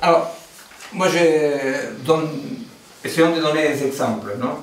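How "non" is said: ordinary talk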